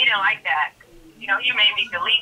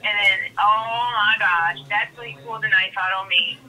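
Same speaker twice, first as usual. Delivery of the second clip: ordinary talk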